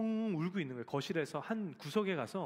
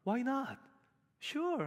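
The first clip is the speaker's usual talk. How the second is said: much higher, cheerful easy-going American teacher; breezy American teacher; 8 semitones higher, much slower than usual. Retold or imitated. Father imitating his son's American teacher, light cheerful yes. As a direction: toss it off like a friendly American teacher. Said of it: "여기는 미국이니까".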